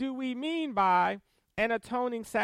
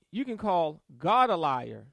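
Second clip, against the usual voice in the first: ordinary talk, nothing marked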